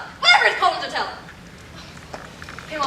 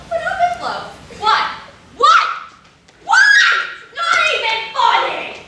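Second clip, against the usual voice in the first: outraged wail; 13 semitones higher, 4 dB louder than usual. Acted. Lorraine's outrage at Beverly's prank.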